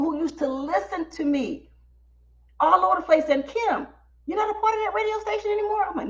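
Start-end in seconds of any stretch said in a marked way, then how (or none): 3.4-5.8 high, quick incredulous question; rushed, high-pitched asking; surprised fan. Retold or imitated. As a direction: imitate a fan's surprise. Surprised fans, retold.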